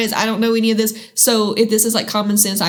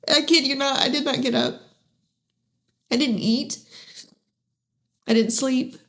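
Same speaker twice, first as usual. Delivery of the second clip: higher, softer voice fighting back tears; holding back tears; 4 semitones higher, 4 dB quieter than usual. Real, not acted. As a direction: speak through real tears, barely holding on. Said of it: "I'm trying not to cry".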